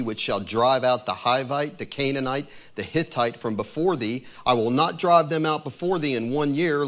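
measured, solemn reading of scripture; steady, reverent Bible reading. Acted. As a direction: read it like holy writ, deliberate. Reads scripture passage aloud.

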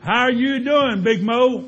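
loud greeting. Acted. Girlfriend calls out greeting.

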